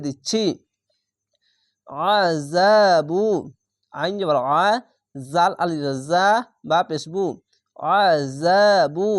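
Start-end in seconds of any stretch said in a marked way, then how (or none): 2.1-3.2 very slow আযাবু with যা held one alif; thick ain, then stretch যা in আযাবু; drawn-out recitation. Shown as a demonstration. Teacher showing the difference to students, আযাবু slow and drawn out. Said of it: "এক আলিফ টেনে পড়বেন". 7.9-9.1 slow chant of আযাবু with long যা; drawn-out recitation. Shown as a demonstration. Teacher repeating after spelling, আযাবু slow and stretched. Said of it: "এক আলিফ টেনে পড়বেন".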